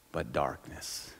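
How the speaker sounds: hushed. Real, not acted. Solemn repeated phrase.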